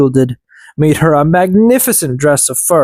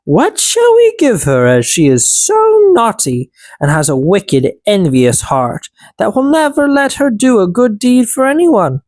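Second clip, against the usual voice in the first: higher elf voices, a touch stern; elves conferring; 6 semitones higher than usual. A narrator's reading. Elves judging the wicked sister, higher voices. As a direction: judge her aloud, pitched up. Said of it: "the little men said".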